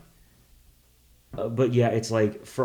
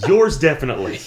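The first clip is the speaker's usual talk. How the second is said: laughing; 4 semitones higher, 8 dB louder, faster than usual. Real, not acted. Teasing while laughing.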